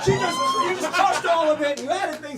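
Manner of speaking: loud, high ejection call; mock-outraged ruling. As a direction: throw the player out like a fired-up game official. Host hammily ejects a player.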